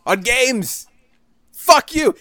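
high, loud mock meltdown; hammed-up outrage. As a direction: play the hysterical customer, overacting. Mock panic then mock rage.

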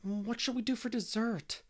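high-pitched, mildly rueful question; regretful. A narrator's reading. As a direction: ask with a touch of dismay. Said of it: "looking regretfully at the wreck".

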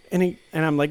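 normal talking voice

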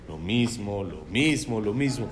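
low, soft monotonous repeating; bored repetition. Acted. Complaint about praying three times daily, bored repetition. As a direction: drop the voice and repeat it wearily.

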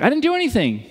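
high, whiny insistence; protesting Job. Retold or imitated. Job insisting to his friends he did nothing, high and whiny. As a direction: plead your innocence up high. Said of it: "He's like".

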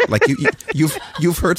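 words broken up by real laughter; laughing. Real, not acted. Host cracking up over a chat message, laughing. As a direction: laugh your way through it.

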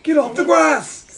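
very high, loud, drawn-out shout; mock yell. Correcting the movie quote about the grass, mock yell. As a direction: holler it in imitation of an outraged man. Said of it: "Yelling at them".

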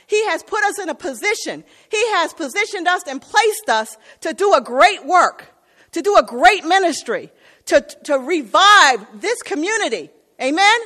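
loud, high preacher's climax; preaching at full force. Acted. Preacher rallies the church to revive the community, full force. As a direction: lift the congregation to the great work.

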